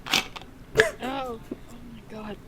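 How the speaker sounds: usual speaking voice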